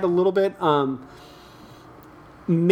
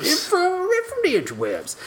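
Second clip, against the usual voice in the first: prospector voice. Pretend. Repeats in prospector voice.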